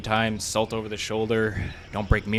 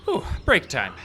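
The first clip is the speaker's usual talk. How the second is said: usual speaking voice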